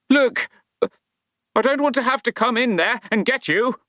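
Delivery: high, nervous bluff of a scared watchman; a jittery guard warning an unseen intruder; frightened night watchman. Acted. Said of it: "said Scattering with heartfelt accuracy".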